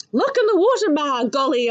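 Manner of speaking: loud, high startled cry; child crying out as something brushes her; spluttering scream. Acted. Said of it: "I spluttered and screamed".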